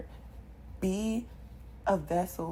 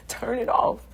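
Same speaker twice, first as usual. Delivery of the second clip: emphatic command; 5 semitones higher, 6 dB louder than usual. Shown as a demonstration. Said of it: "if it's irritating you".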